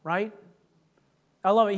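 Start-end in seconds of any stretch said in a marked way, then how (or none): none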